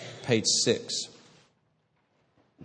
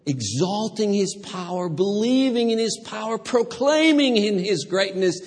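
rising fervor; 7 semitones higher, 4 dB louder than usual. Real, not acted. Preacher builds to climax.

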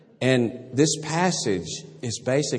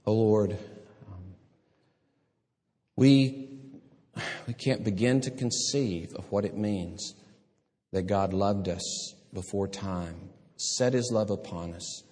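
slow prayer; slower than usual. Real, not acted. Closing prayer.

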